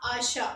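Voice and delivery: आशा said softly as a-SHA, the t silent; आशा with no t at the end; French word achat. Shown as a demonstration. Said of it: "इसे हम क्या पढ़ेंगे?". (French उच्चारण में आशा, टी silent)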